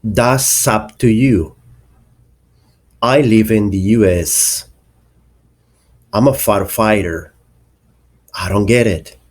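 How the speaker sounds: "That's up to you. I live in the U S. I'm a firefighter. I don't get it." with final consonants run into the next vowel; linked words. Shown as a demonstration. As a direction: link each final consonant to the following vowel. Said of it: "The link of words".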